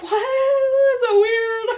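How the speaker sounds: high, slow, stretched-out amazement; amazed reaction. Retold or imitated. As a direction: act out being stunned, high and slow. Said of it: "and I was like".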